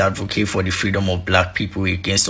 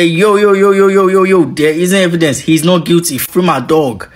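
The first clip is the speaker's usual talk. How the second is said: loud high "yo, yo" call; excited shout; 9 semitones higher, 7 dB louder than usual. Retold or imitated. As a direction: shout it like a prosecutor gone wild. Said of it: "prosecutor ... came back and said".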